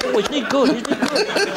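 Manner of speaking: very high, fluting mock admiration; gushing fan. Mocking the crowd praising an impressionist. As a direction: coo like an impressed audience. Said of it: "And they go".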